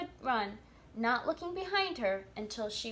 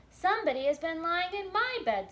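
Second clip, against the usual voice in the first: higher and louder mama bear; lightly voiced mother bear, raised; middle-sized bear voice; 7 semitones higher, 5 dB louder than usual. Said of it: "in her middle-sized voice".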